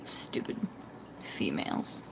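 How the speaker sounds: breathy under-the-breath whisper; whispered insult. Real, not acted. Whispering a jab at the mollies. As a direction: hiss it so the stream barely hears. Muttering at mollies who hurt fish.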